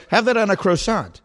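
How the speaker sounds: a hint of someone ordering at a counter, pitch up; imitating customers. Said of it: "I hear people say".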